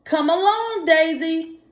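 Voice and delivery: high coaxing call to her duckling; mama duck calling. Acted. Mama Duck coaxing Daisy away from the egg, high call. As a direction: call the little one gently but high. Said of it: "Mama Duck said".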